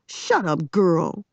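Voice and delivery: raspy voice. A narrator's reading. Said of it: "A voice came rasping".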